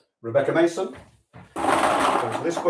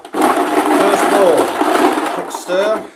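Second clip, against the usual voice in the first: higher and louder, mock game-show thrill; hammed-up excitement; 6 semitones higher, 8 dB louder, much slower than usual. Host drawing the sweepstake, mock excited announcer. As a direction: play the big draw moment up for laughs. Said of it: "We're excited".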